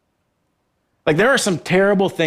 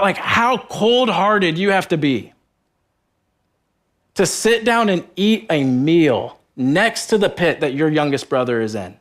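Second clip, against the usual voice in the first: ordinary talk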